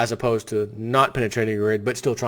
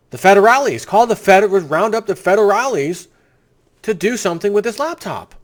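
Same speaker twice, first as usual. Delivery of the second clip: higher, louder sarcastic jeer; mocking sarcasm; 6 semitones higher, 5 dB louder than usual. Real, not acted. Host mocking the governor's call for federal help, sarcastic. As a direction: ridicule the idea of calling the feds.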